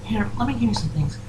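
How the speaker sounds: high, soft pony voice; Fluttershy. Acted. Voices Fluttershy talking to the player.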